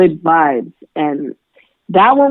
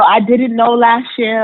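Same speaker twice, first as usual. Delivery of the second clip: laughing; 4 semitones higher than usual. Real, not acted. Laughing while answering.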